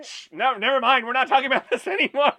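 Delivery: loud, high, fast mock protest; mock hushing. Cutting off friend's talk of old email, mock outburst. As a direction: shut the topic down in playful outrage. Jokingly shuts down the topic.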